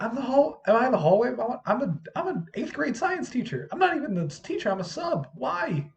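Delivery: high, flustered protest; retold exasperation. Sub breaking up a hallway fight, high exasperated rant. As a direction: imitate yourself ranting at squabbling students.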